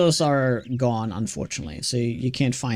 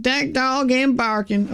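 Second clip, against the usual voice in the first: higher, slow Southern drawl; Southern accent; 9 semitones higher, slower than usual. Retold or imitated. Streamer quoting an American phrase in a slow Southern accent. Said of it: "Southern phrases where it's like".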